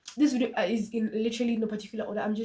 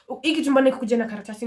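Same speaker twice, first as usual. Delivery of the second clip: ordinary talk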